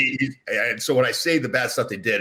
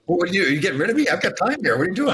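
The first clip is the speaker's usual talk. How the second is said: mock offended; 9 semitones higher than usual. Guest teasing the host who is wrapping up, mock offended. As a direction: pretend to be hurt at being cut off. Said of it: "I'm just kidding".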